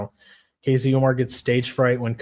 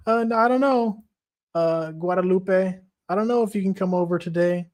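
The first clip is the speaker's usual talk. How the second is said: high, hesitant put-on voice; Useless impression; 5 semitones higher than usual. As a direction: stall nervously as a reluctant suitor, pitched up. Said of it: "Useless is like".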